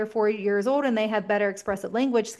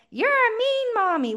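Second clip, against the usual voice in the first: put-on much higher whiny little-kid voice; high pouty toddler; 12 semitones higher than usual. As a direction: pout it like an upset toddler. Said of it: "And then".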